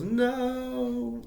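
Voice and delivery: mock gang yelling no together; biker refusal. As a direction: shut him down with one yelped word. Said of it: "biker gang in the bar goes".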